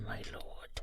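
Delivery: whispered, barely voiced; hushed whisper. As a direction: breathe it out to your lord in a hush. Hushed reply to the lord.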